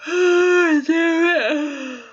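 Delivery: a yawn breaking through; loud yawn. Real, not acted. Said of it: "I cannot do anything about the yawning".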